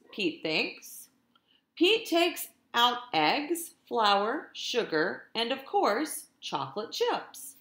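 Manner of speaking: lively, sing-song storytime reading; animated picture-book narration; reading to children. A narrator's reading. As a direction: read it like a teacher to her class. Teacher reads picture book aloud.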